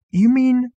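high, loud disbelief; astonished. A narrator's reading. Arthur questioning Marvin, astonished. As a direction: voice leaping up in astonishment. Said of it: "Arthur stared in astonishment".

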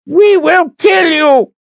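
very high, loud drawn-out shout; shrieked death threat; furious threat. Acted. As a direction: scream it as an enraged old leader. Old leader threatens Richard.

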